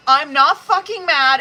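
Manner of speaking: loud, high-pitched insistence; emphatic protest. Real, not acted. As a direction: protest it loudly, pitch jumping up. Insists repeatedly she is not mad.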